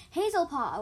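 high, loud excited call; excited yell. A narrator's reading. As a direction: call out to a friend, thrilled. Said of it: "a voice yelled excitedly".

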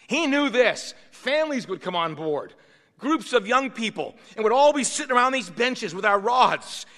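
preaching at full force. Acted. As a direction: preach it out to the congregation.

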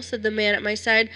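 usual speaking voice

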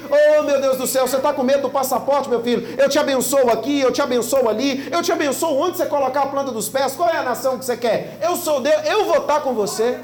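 pastor voices God to the worrying faithful, rising into fervent preaching; God voice building. Acted. Pastor speaking as a proud God, fervent.

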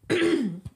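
rough throat clear. Real, not acted. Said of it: "excuse me".

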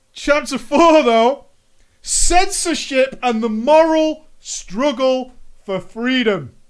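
loud, high, slow proclamation; hammed-up, stretched-out announcer delivery; grand chapter announcement. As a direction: proclaim the title with mock-epic weight.

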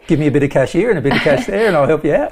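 quick, slick Aussie hustler; fast-talking Aussie asking for cash; aussie tradesman. Retold or imitated. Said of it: "would step in and go".

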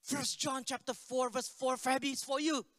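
high, loud preaching, building in force; commanding prayer. Retold or imitated. Pastor prays over girl to free her, forceful building command. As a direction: declare scripture over someone, ending on a forceful command. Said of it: "we prayed over her".

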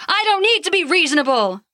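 put-on the old king of gods refusing to budge; Zeus, gruff old god. A narrator's reading. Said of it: "the old god said".

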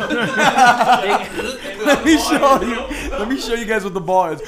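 words squeezed out through laughter; can barely get it out for laughing; stretched, giggling offer. Real, not acted. Laughing while offering to show.

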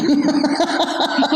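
a burst of high laughter; real laugh. Real, not acted. Laughing at the blunt vet's remark.

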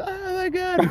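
hammed-up crybaby wail; mock crying. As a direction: imitate a kid whimpering after a beating. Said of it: "starts crying and shit".

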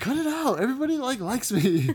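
high, loud whiny protest; loud, high comic pleading; mock indignant yelling. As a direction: shout down the hecklers, half laughing. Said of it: "when they start yelling, Shayna's like".

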